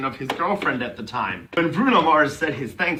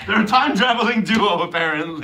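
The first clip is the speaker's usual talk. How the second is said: higher, louder, giggly aside; laughing quip; 7 semitones higher, 5 dB louder than usual. Real, not acted. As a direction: toss off the joke while laughing. Joking aside, cracking up.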